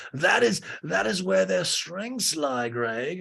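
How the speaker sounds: high, drawn-out put-on voice; Lisa. Lisa defends them to Greg, high slow imitation.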